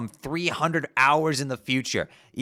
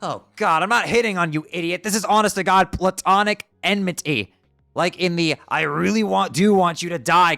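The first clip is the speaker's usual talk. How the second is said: loud, high exasperated outburst; loud furious exasperation; exasperated yell; 7 semitones higher, 7 dB louder than usual. Acted. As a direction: shout him down in disgust. Furious rebuttal of flirting accusation.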